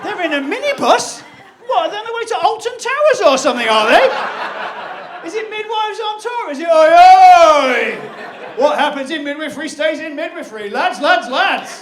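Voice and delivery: loud, high laughing jeer; mock a rowdy lads' trip chant; incredulous mockery.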